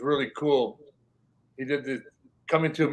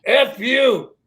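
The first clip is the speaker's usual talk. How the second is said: loud, higher mock yell; hollered insult; 8 semitones higher, 8 dB louder than usual. Neighbour hollers back in the TikTok clip. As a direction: shout it back across. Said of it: "hollers on the other side goes".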